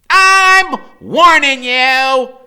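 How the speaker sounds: high, loud, slow warning; fierce, bold. A narrator's reading. Freak warning Killer Kane, fierce and bold. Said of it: "in that fierce, bold way".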